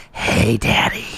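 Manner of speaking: sultry breathy whisper, hammed up; playful whispered impersonation of the waitress; mock whisper. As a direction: whisper it like a teasing come-on. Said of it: "she, she whispered it".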